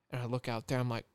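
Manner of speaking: ordinary talk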